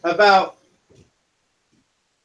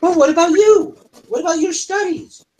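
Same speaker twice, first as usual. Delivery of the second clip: high tavern owner; 9 semitones higher, 4 dB louder than usual. Acted. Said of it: "She goes".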